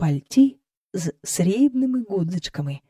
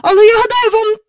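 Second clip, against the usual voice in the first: very high, loud complaint; worked-up servant's pitched-up voice; agitated report; 8 dB louder than usual. Acted. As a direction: blurt out alarming news to the mistress. Flustered cook reports on nanny.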